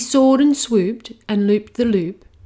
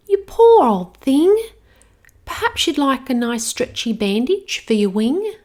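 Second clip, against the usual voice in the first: put-on little girl; 6 semitones higher, 5 dB louder than usual. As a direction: soothe the hurt dragon like a little girl. Girl sympathises.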